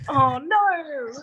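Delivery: high, rising cry of dismay; sudden little wail at the mishap; real startled oh no. Real, not acted. Guest reacts to camera mishap.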